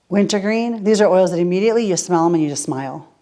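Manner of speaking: normal talking voice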